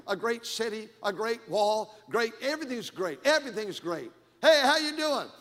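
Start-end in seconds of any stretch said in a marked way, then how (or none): 4.4-5.3 loud, high, hearty greeting; hearty greeter. Acted. Greeter hailing the pastor in church, hearty and loud. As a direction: call out a big hello to the pastor.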